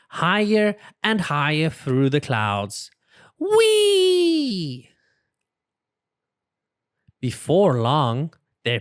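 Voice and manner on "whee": high gleeful whee; excited high-pitched whee. A narrator's reading. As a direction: squeal it like flying up. Plane flying high, exclaim.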